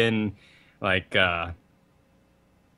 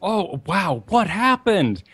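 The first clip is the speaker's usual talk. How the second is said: high, louder imitation of shocked concern; gushing sympathy; 8 semitones higher, 4 dB louder, slower than usual. Co-host voicing friends seeing a broken arm, mock sympathetic shock. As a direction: play the well-meaning friend, aghast. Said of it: "everyone is sympathetic".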